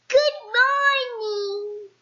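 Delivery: high, slow goblin greeting. Acted. Goblin greets Mister Uppity, high and drawn out. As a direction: sing out a sly goblin hello.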